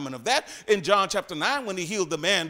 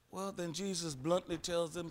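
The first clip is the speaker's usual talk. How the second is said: quiet preaching; 2 semitones lower, 11 dB quieter than usual. Acted. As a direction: draw them in quietly. Quiet teaching stretch.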